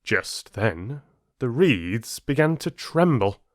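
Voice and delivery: building, tense fable narration; storyteller describing a hurricane to listeners; story narration. A narrator's reading. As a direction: tell the storm with rising tension. Storm part of fable.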